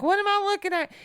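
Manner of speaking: very high, loud panicked question; retold freaking out. Scared mother to screaming son, high retold panic. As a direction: re-enact a frantic squeal of alarm. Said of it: "Well, I'm freaking out".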